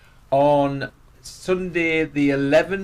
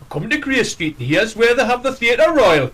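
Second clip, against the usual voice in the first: higher, slow, announcer-style tour voice; bus announcer voice; 7 semitones higher, 4 dB louder than usual. Retold or imitated. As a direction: read it like a bus tannoy guide. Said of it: "a bloke and a woman sort of tell you".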